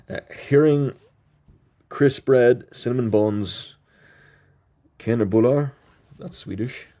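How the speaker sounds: usual speaking voice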